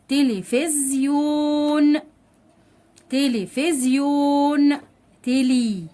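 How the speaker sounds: تلفزيون very slow and high, "tee-lee-fiz-yooon"; تلفزيون sounded out syllable by syllable on a held note; long-vowel demonstration. Shown as a demonstration. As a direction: stretch each long vowel in تلفزيون, then تلي alone. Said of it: "لي مد بالياء".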